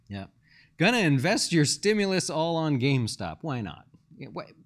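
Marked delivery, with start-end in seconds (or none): 4.2-4.5 dry, sarcastic "how could I argue with that?"; wry, mock agreement. Real, not acted. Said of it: "He says sarcastically".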